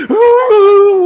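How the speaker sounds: high, drawn-out imitated crying; loud acted wail; mock wailing sob. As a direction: wail as if overwhelmed. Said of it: "막 울고 있는 거야".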